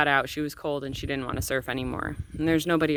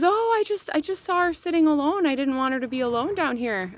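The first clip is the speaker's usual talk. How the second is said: mock innocent stranger; 11 semitones higher than usual. Stranger's excuse retold.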